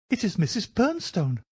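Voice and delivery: high-pitched nervous little man; high, agitated character voice; anxious Thaddeus Sholto. Acted. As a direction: pitch it right up, fretful.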